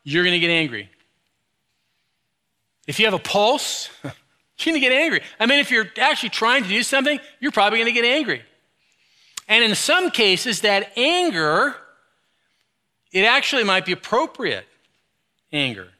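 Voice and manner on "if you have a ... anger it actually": rising fervor. Real, not acted. Preacher building emphasis.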